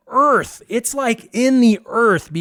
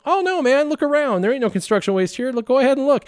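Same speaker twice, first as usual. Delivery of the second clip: innocent; 7 semitones higher, faster than usual. Retold or imitated. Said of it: "I'm like".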